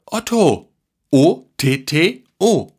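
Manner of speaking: high excited shout, then slow spelling; calling out loudly. A narrator's reading. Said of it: "Dann rief er laut". (laut herausgerufen)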